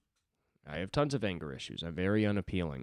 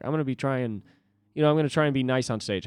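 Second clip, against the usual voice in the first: higher, louder, fast self-pep talk; eager resolve; 6 semitones higher, 5 dB louder, faster than usual. Retold or imitated. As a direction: psych yourself up quickly. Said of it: "I was like".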